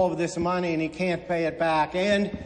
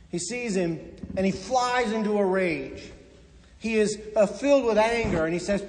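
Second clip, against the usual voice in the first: ordinary talk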